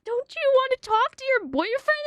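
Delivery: high, loud mock-coaxing mom voice; exaggerated high parental prodding; imitated mom. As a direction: coax like a mother to a small child. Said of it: "she was like".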